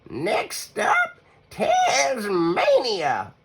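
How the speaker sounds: very high, Tasmania stretched out slowly; eager grandma. Acted. Grandma Poss announces Tasmania to Hush, very high drawn out. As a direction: announce it with glee.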